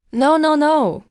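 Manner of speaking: high, loud, frantic no; desperate cry of no; panicked protest. Acted. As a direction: beg in sudden panic. Being stripped of office.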